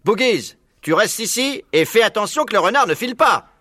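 high, emphatic commanding farmer voice; barking orders. A narrator's reading. Bean ordering Bogies to guard, commanding.

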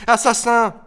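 put-on high, loud child's yell; screamed accusation. A narrator's reading. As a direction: yell it in outrage. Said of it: "hurla Émile". (hurlement accusateur, aigu)

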